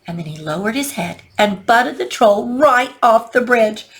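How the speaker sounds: ordinary delivery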